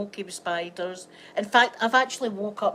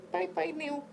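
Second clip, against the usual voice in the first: put-on baby voice goodbye; 8 semitones higher, 4 dB quieter than usual. Farewell to cut nail, baby voice.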